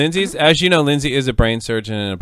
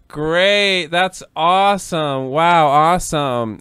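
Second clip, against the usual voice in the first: slow, drawn-out, hollow praise; feigned delight; 4 semitones higher, much slower than usual. Retold or imitated. Daughter reacting to unwanted revived father, feigned delight. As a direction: fake being pleased, stretching each word. Unhappy response to dad revived.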